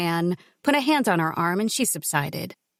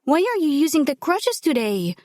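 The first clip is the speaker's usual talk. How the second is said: worried; 8 semitones higher than usual. Acted. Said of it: "worry in her voice".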